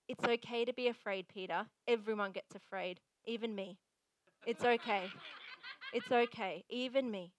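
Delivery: low, soft movie-style comfort; soothing reassurance. Pretend. Mock movie Jesus comforting frightened Peter, soothing reassurance. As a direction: hush it down to console, hammed-up tenderness. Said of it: "heard this in a movie".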